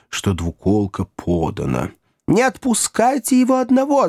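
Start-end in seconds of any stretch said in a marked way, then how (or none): 2.3-4.1 very high-pitched panicked women's cry; squealing. A narrator's reading. Women pleading that he not go alone, squealing. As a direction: shriek it, voice at the top of its range. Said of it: "завизжали женщины".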